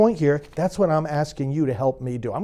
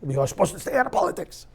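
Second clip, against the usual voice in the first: mocking the critics; 5 dB quieter, faster than usual. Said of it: "they say that".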